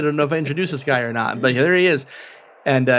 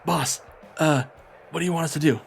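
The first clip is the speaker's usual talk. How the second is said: timid Callias; 2 semitones higher, 4 dB quieter than usual. Acted. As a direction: ask your boss meekly like an eager puppy. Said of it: "gets close to you and goes".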